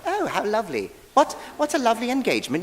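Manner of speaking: high, fast, dainty tea-party voice; sip-your-tea mock refinement; dainty spectator mocking calm viewers; prissy mock spectator. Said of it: "you're not just watching it like".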